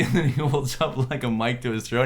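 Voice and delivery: ordinary talk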